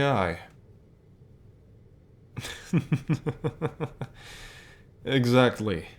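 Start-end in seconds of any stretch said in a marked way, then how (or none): 2.4-4.0 a short, quiet amused laugh; soft chuckle. Acted. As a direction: chuckle softly to yourself. Boss amused by listener's reply.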